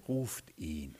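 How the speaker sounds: low, hushed, composed order; quiet command. Retold or imitated. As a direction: drop right down and say it softly. Jesus stops and quietly gives the order. (leiser, tiefer Befehl)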